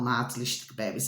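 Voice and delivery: quiet murmured aside to viewers; low soft aside. Real, not acted. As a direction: drop the endearment under your breath. Pet-name aside to viewers.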